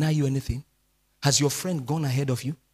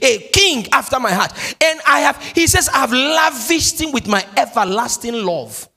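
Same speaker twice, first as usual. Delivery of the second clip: higher and louder, ringing preacher's cry; preaching at full force; 9 semitones higher, 9 dB louder than usual. Acted. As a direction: preach it out to the crowd.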